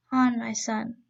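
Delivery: ordinary delivery, nothing marked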